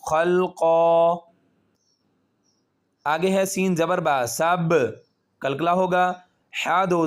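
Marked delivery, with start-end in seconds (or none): 0.0-1.3 खलक़ा with a strong qalqala echo, slow and louder; pausal form. Shown as a demonstration. Teacher demonstrating stopping on the word. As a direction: bounce the क़ at the stop. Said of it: "वक्फ करेंगे तो".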